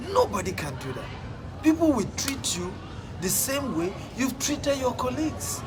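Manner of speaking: usual speaking voice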